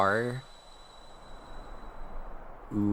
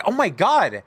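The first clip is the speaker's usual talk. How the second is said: startled; 9 semitones higher, 7 dB louder than usual. Real, not acted. Startled by fast game text.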